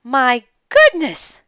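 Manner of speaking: high, loud, drawn-out astonishment; startled exclamation, leaping up in pitch; gasped. A narrator's reading. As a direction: gasp it in shock. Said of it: "gasped the woman".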